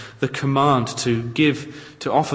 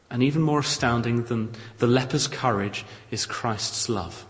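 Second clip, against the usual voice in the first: ordinary talk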